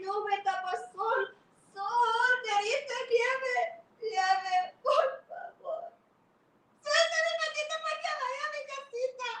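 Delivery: high, loud desperate plea; desperate ant. Acted. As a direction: cry out one last plea. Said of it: "She said".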